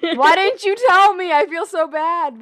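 high, loud embarrassed shock; horrified she was chewing loudly; embarrassed surprise. Real, not acted.

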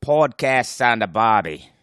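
put-on loud, slow jokey Aussie accent; hammed-up Australian announcer; mock Australian. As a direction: ham up an Australian ad voice. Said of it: "It's Australian for podcast".

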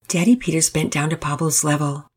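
ordinary delivery, nothing marked

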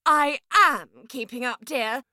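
high-pitched, prickly retort; testy pear. A narrator's reading. Pear snaps at the grapefruit, testy. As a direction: answer back in a squeaky put-on voice. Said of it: "hissed the pear".

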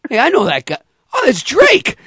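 retelling a delighted double take; surprised recognition. Retold or imitated. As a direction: voice jumps up high in sudden recognition. Retelling spotting [name] backstage.

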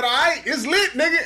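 high, laughing hype; excited, laughing. Retold or imitated. Girl's quoted reply.

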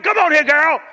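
high, loud fatherly call; loud summons. Retold or imitated. Father calling his crying daughter to him, loud high call. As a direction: call the child over urgently.

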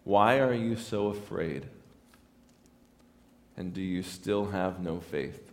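gentle, hushed question from the text; soft quote. Retold or imitated. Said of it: "he says these two phrases".

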